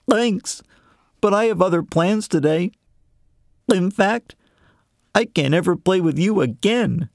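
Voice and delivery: high, nervous little frog; lifted, uneasy frog. Acted. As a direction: turn down a friend from behind the door. Frog calls through the door.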